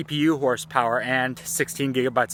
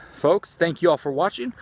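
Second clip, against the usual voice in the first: usual speaking voice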